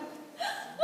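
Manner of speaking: sharp high gasp of surprise; surprised gasp. Acted. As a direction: gasp in shock. Character surprised by tiny man.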